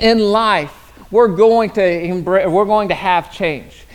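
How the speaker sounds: ordinary talk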